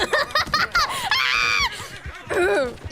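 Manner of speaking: a high howling burst of laughter; shrieking laugh. Real, not acted. Streamer cracking up after telling a stranger off, howling laugh. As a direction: let a loud high cackle fly.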